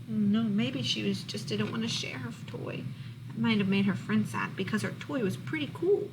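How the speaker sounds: ordinary delivery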